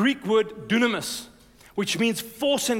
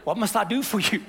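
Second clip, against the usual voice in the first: lightly voiced God. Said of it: "And God's going".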